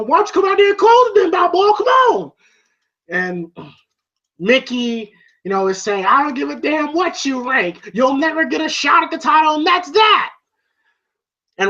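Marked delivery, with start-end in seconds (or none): 0.0-2.3 high, fast mock shout; shouted challenge. Challenger badgering Rocky on, shouted imitation. Acts out quarrel, challenge to fight. 6.1-10.3 high-pitched mock tirade; angry dismissal. Mickey refusing the challenger a title shot, angry imitation.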